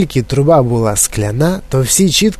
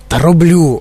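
mildly irritated, higher-pitched retort; snapping back; 4 semitones higher, 3 dB louder than usual. A narrator's reading. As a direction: snap back at your wife, held back. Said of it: "огризнувся пан Глуп". (роздратовано огризається)

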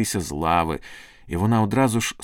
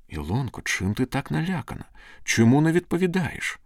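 worried questions climbing higher; anxious questioning; 7 semitones higher than usual. A narrator's reading. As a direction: ask with mounting concern. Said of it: "із тривогою запитав Андрій".

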